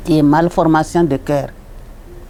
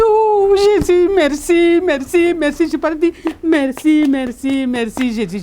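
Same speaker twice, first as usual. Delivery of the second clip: ecstatic thanks; 9 semitones higher than usual. Retold or imitated. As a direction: cry out your thanks to heaven, pitched high. Thanking Jesus, overjoyed.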